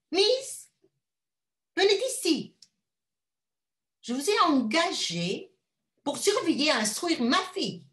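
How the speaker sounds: high, loud imperious lady; imperious mother. Acted. Rich mother ordering the governess, high and imperious. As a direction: summon her like a servant.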